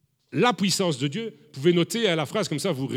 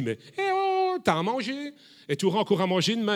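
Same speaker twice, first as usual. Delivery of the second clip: high, bright-voiced mock God answering; God teasing the worrier, pitched up; God's reply; 7 semitones higher than usual. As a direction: play God brushing off the worry, higher.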